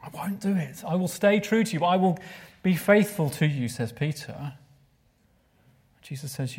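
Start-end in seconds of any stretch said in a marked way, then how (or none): 0.0-3.7 higher, louder pleading vow; emphatic insisting. Acted. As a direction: insist hard, like you'd die first. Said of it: "He insisted emphatically".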